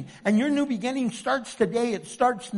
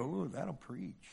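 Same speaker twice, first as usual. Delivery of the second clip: quiet aside; 7 semitones lower, 14 dB quieter, slower than usual. Retold or imitated. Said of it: "I go".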